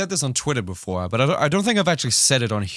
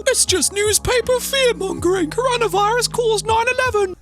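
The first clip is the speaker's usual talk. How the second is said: much higher, dopey voice mocking a virus denier; mocking conspiracy idiot. Ranting vlogger mimics a coughing virus denier, high and dopey. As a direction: sneer at them in a silly high voice. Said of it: "they'll be like".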